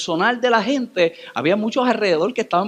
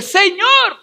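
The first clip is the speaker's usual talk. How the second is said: crying out; 12 semitones higher, 5 dB louder than usual. Acted. María falls at Jesus' feet.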